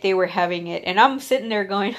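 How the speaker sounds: ordinary talk, nothing marked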